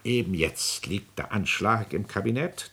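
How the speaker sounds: ordinary talk, nothing marked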